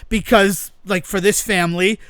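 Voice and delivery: ordinary talk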